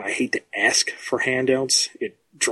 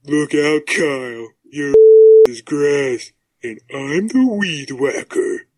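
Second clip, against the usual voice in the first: slow, loud tough-guy threat; hammy action-hero taunt; Smalls the Cat voice; 7 dB louder, slower than usual. Shown as a demonstration. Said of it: "So that would be Smalls the Cat".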